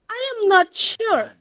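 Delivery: I AM NOT SURE starting high, then dropping; high demonstrated tune with a steep fall on "sure"; fall intonation. Shown as a demonstration. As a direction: arch up and let "sure" fall. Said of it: "it is a fall intonation".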